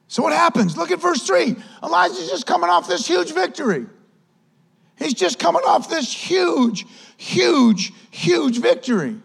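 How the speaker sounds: high, urgent sermon delivery; fired-up preaching. Real, not acted. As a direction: preach it up high, driving each point home. Preaching at raised pitch.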